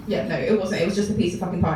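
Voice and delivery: ordinary delivery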